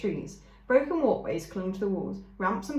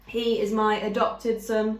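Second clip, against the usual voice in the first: slow, even, slightly louder robot voice; a calm, firm robot mother who refuses to leave her son; 5 dB louder than usual. A narrator's reading. Robot mother refusing to leave her injured son, slow and firm. Roz explains.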